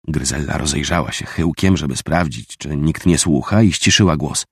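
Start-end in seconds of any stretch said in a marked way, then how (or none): none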